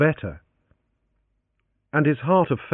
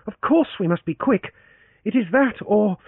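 put-on a higher, urgent young woman's voice; Leoncia, pressing; 10 semitones higher than usual. A narrator's reading. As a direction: trail off into dread at the end.

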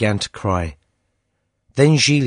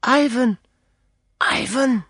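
put-on girl's whisper; 13 semitones higher than usual. A narrator's reading. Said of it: "and whispered".

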